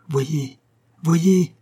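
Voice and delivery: from plain to a loud high cry; building cry. A narrator's reading. The rose bush calls to the dying nightingale, building to a cry. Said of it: "cria le rosier".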